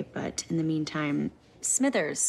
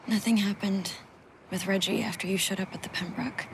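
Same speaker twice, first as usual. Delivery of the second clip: ordinary talk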